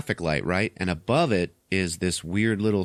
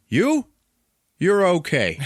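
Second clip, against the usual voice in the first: high, raised retold pronouncement; single someone out with an exaggerated call; pointed verdict; 10 semitones higher, 4 dB louder, slower than usual. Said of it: "and he's like".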